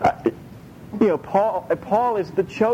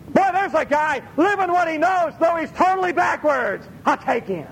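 delighted pick; 10 semitones higher than usual. Pretend. God shopping, picks Paul.

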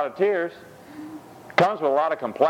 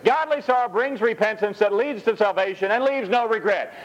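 scripture reading; 7 semitones higher than usual. Acted.

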